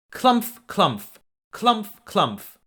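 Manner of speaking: stomp it out like big hooves; big goat's pounding steps; heavy clumph steps. A narrator's reading.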